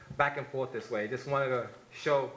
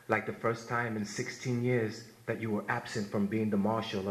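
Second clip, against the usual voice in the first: poem recitation; 5 semitones lower than usual. Acted. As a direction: read it like a eulogy for a fallen brother.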